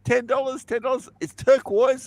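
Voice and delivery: high, wheedling sales pitch; pushy street seller. Retold or imitated. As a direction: coax like a dodgy hawker. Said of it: "It's like".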